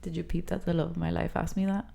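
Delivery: ordinary delivery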